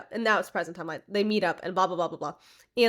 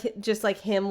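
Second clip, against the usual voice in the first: ordinary delivery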